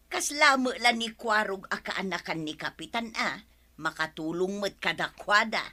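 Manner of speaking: ordinary talk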